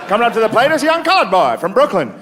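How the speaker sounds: very high vintage radio sportscaster; hammed-up play-by-play, stretching the big moment; old-time announcer. Announcer calling Jackie's first at-bat, old-time announcer voice. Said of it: "can hear the announcers like".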